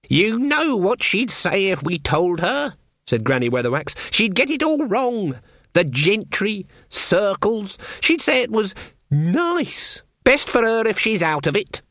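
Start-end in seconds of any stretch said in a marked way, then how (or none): 0.1-2.7 put-on high, slow old witch voice; testy old witch. Granny argues with Nanny, voiced. 4.1-11.8 put-on high-pitched, opinionated old witch; disdainful old witch. Voices Granny, disparaging.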